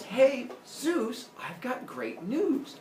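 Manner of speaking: high, drawn-out singsong announcement; excited girlfriend. Acted. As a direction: imitate a girlfriend breaking happy news. Voicing the goddess with news.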